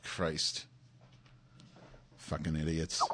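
low muttered insults; muttered under breath. Real, not acted. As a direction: grumble it quietly to yourself. Muttering insults after hanging up.

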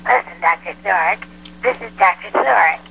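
normal talking voice

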